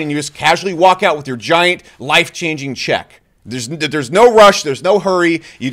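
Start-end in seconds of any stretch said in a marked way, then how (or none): none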